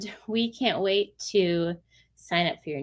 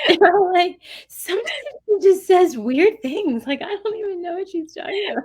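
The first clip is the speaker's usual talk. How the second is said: puzzled friends; 11 semitones higher, 6 dB louder than usual. Retold or imitated.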